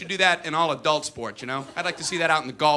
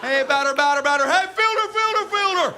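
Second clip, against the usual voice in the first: loud chant, far higher on FIELDER; mock call-and-answer between batter chant and higher echo; infield chatter; 10 semitones higher, 4 dB louder than usual. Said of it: "Chattering is saying".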